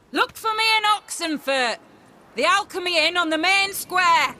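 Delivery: high, loud holler after someone leaving; yelled directions, pitched high; shouted parting call. Acted. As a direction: call the meeting place out across a distance. Shouting directions to departing man.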